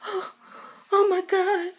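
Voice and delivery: gasped, high-pitched disbelief; mimicked shock. Retold shocked reaction to seeing someone.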